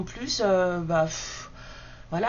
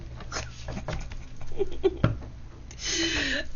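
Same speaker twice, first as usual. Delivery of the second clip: high breathy giggle; 10 semitones higher than usual. Real, not acted. Rit de sa blague d'oiseau. (rire aigu et soufflé)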